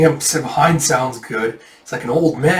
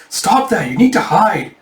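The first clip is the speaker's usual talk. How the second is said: raised, insistent acted warning; urgent warning; 6 semitones higher, 4 dB louder than usual. Villager grabbing the kid to hide him, urgent. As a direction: rush the kid to safety.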